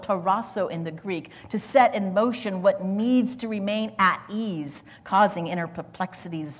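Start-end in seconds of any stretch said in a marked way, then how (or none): none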